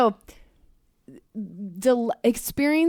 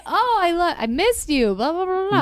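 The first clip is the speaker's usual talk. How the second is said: squealing high and excited; high-pitched lovestruck gushing; giddy delight; 9 semitones higher, 4 dB louder, slower than usual. Retold or imitated. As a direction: gush like new lovers reuniting. Early romance delight on seeing partner.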